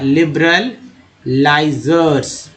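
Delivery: लिबरलाइजर्स very slowly, with a pause after लिबरल; slow pronunciation. Shown as a demonstration. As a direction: draw out लाइ and जर्स in लिबरलाइजर्स. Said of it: "words पे ध्यान देना होगा".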